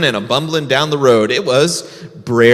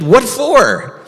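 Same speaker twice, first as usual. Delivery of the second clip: curious bear; 9 semitones higher than usual. Acted. Bear questions rabbit.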